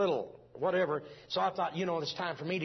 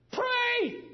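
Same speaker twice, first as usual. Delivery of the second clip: one sharp, much higher and louder cry; imitated scream; 7 dB louder than usual. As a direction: shout it like the mother commanding prayer. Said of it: "She screamed".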